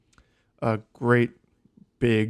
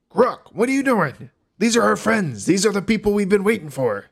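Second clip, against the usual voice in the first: Dirk calling out; 10 semitones higher, 5 dB louder than usual. Acted. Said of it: "Dirk is like".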